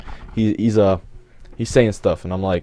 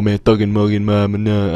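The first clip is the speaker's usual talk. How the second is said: imitation of garbled street slang; mock thug gibberish; 3 semitones higher, 4 dB louder than usual. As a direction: babble like a wannabe thug. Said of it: "Just speak English".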